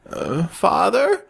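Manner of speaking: put-on high, hesitant old woman's voice; startled elderly churchgoer; older woman. A narrator's reading. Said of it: "the older woman said".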